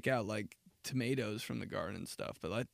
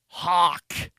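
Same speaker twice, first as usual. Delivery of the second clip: calling out; 5 semitones higher, 11 dB louder than usual. Acted. Calling out pig cuts.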